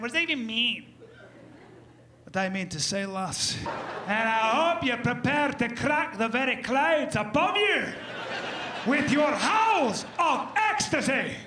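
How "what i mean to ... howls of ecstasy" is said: slow, booming Scottish brogue building to a shout; Scottish accent. Retold or imitated. Angus warning the lass about the man, in a rising Scottish brogue. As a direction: swell into a grand Scottish warning. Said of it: "walk away with that weird accent".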